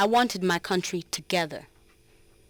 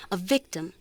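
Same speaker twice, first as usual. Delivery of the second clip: ordinary delivery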